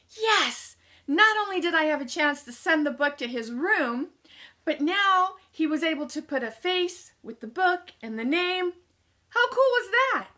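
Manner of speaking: high, rising elated thoughts; building to a squeal of delight; inner cheer. Retold or imitated. Inner cheer after elevator meeting.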